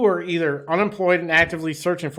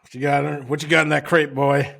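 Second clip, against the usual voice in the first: mock southern cop; 4 dB louder than usual. Mocking cop interrogating cheese runner.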